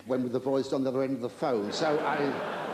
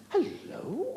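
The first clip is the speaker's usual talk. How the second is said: high, questioning hammed-up hello; mock surprise. Comic reacting to a ringing phone, mock surprise. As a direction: put on exaggerated, high-pitched wonder.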